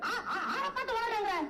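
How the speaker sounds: high-pitched, stuttered comeback; indignant spluttering. Acted. Poet accused of babbling, spluttering back indignantly. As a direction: splutter on the first word in outrage. Retorts to being called a babbler.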